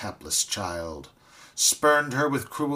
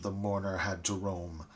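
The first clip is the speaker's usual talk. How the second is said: ordinary delivery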